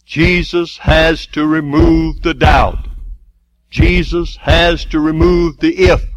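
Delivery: slow, loud, emphatic preaching, building in power; full-force preaching. Acted. Preacher driving the congregation toward faith, full force. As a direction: preach it out, hammering each phrase.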